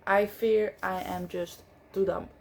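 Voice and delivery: normal talking voice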